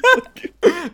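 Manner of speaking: loud high giggle; high burst of laughter. Real, not acted. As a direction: let a big laugh out. Big laugh at Tetris two.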